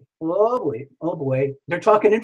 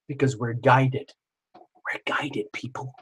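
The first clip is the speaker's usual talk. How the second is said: hushed; 4 semitones lower, 8 dB quieter, slower than usual. Acted. Tarot reader hushes secret message.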